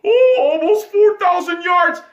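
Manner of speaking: loud, high, slow mock praise; sarcastic shout. Fan mocking Aaron Rodgers' stats to his fans, sarcastic shout. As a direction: bellow it as a sneering fake compliment.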